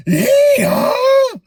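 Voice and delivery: comic donkey hee-haw; donkey bray. Acted. Donkey Jack suddenly stops.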